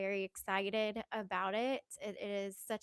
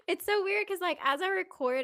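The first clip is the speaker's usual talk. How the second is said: excited; 9 semitones higher, 8 dB louder than usual. Real, not acted. Sudden burst after laugh.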